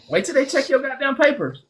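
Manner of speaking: ordinary delivery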